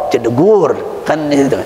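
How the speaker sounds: ordinary talk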